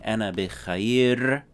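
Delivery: slow demo. Shown as a demonstration. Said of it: "So yeah".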